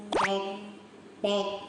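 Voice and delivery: short, abrupt sambar honk; imitated deer bark; sambar deer call. Shown as a demonstration. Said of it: "sambar कसं ओरडतं?".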